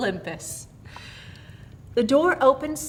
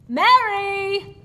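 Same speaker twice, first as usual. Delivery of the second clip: calls her name out loud and high; 11 semitones higher, 10 dB louder than usual. A narrator's reading. James testing whether Mary can hear, calling her name loudly. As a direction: call Mary sharply to test if she hears. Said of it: "And then he said loudly".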